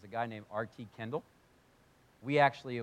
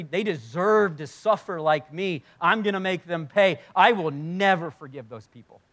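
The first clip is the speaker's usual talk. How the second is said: louder, building vengeful protest; acted bitter vow; 3 semitones higher, 8 dB louder than usual. Wronged pastor vows to make them pay, acted angry outburst. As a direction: work yourself up into a resentful vow. Acting out R T's vengeful complaint.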